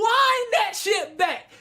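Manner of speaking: high, slow outraged shout; hammed-up high-pitched demand; incredulous protest. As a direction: drag out each word, yelling. Re-enacts protest at the screen.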